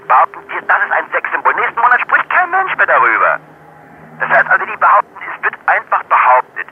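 loud, high-pitched furious tirade; spitting fury, voice pushed high and loud; angry rant. Real, not acted. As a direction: rage at the gossip papers. Kinski ranting against tabloid magazines.

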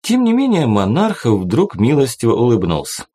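ordinary delivery

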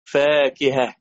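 usual speaking voice

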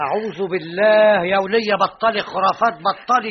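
loud, high exasperated rebuke; scornful scolding. Acted. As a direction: shut down her nonsense. Scolding superstitious woman. (توبيخ عصبي بصوت عالٍ)

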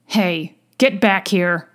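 restrained mock yell; understated angry shout; fuming yell. A narrator's reading. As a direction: call after them crossly, at moderate volume. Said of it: "yelled from far behind him, audibly fuming".

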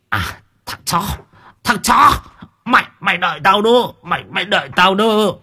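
beaten man's threat, leaping to a high cry; threatening. A narrator's reading. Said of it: "hăm dọa Chín". (hăm dọa, giọng vút cao)

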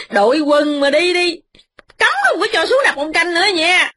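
high, tearful ranting wife; wife's tirade. Acted. Wife rants at her silent husband, high and tearful. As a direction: rant on and on, pitched high. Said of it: "Mợ nói mấy giờ đồng hồ".

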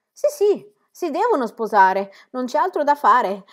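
father repeats his daughter's words, loud and exasperated; loud exasperated answer. A narrator's reading. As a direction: bark it back in frustration. Father answers with bitter force.

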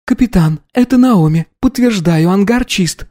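high female voice reporting in; radio confirmation. Acted. Second cadet confirms over intercom.

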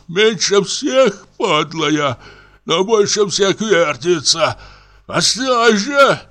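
put-on high, slow, drunken rant about the room; drunk comic raving. A narrator's reading. Drunk comic rants at the spinning room, high and slow. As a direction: reel through it like a paranoid drunk.

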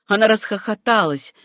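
ordinary delivery

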